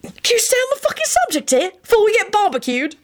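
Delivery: high-pitched exasperated outburst; bellowing. A narrator's reading. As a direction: scold frantically, way up high. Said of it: "Sirius bellowed".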